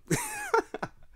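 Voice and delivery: sudden high squeal of laughter; shrieking laugh. Real, not acted. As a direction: burst into a shrill disbelieving laugh. Laughing at player's age.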